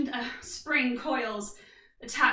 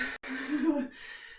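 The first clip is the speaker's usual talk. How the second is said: soft laugh; 5 dB quieter than usual. Real, not acted. Laughs after last rep.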